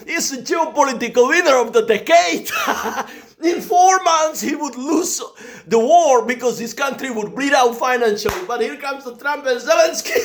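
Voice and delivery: high, loud laughing rant; laughing gloat. Acted. Gloating about Putin winning, laughing high and loud. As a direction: cackle through the gloating.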